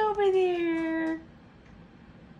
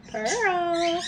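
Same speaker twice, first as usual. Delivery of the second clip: ordinary talk, nothing marked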